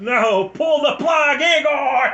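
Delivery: loud, high, unhinged shouting; mad scientist. Pretend. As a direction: rant like a crazed scientist. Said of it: "give me your best impression of a mad scientist".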